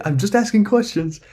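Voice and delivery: high, put-on innocent voice; mock-innocent catchphrase. Host quoting a conspiracy catchphrase, mock-innocent high voice. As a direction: plead innocence in a high falsetto.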